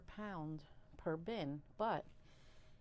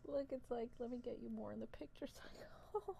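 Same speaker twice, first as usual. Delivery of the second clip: fast giggly whisper, much higher; excited whisper; 6 semitones higher than usual. Real, not acted. Bringing viewers closer to the worms, giddy whisper.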